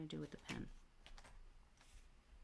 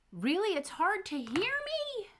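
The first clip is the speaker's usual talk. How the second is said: high, loud startled question; surprised; 8 dB louder than usual. Real, not acted. Startled by viewer saying inaudible.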